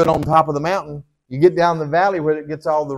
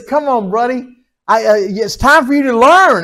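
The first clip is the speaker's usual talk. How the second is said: a lightly voiced father, higher and louder, good-natured; God telling his son it's time to learn; a hint of a patient dad; God, amused; 8 semitones higher, 6 dB louder than usual.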